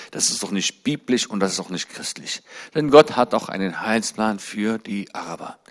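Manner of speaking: ordinary delivery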